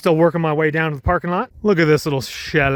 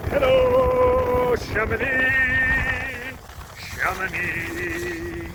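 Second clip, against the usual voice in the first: much higher, very slow, stretched-out hello to the valley; drawn-out greeting; 10 semitones higher, much slower than usual. Real, not acted. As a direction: sing out the name of the place, savouring it.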